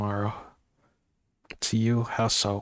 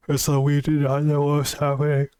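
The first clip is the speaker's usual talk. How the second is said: words stretched through a yawn, higher and louder; 5 semitones higher, 5 dB louder than usual. Real, not acted. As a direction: read it mid-yawn. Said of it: "yawning again".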